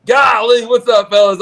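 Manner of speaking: pumped-up bro cheer; fired up. Real, not acted. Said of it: "I get fired up".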